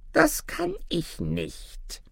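very slow, high little creature; helpless dwarf. A narrator's reading. Dwarf refusing his master's wish, high and helpless. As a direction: squeak it out slowly, high.

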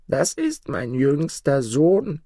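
put-on high, slow old woman's voice; old forest woman. A narrator's reading. Bush mother shows boy her dead son, high slow accusation. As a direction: draw it out high as the crone. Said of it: "sprach sie".

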